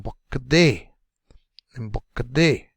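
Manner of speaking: "mbokade" with quick syllables and a long, strong "de"; short, short, short, long. Shown as a demonstration. Teacher modelling the rhythm for learners, short-short-short-long. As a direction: rush "mboka" and land on a held "de". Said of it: "Short, short, short, long".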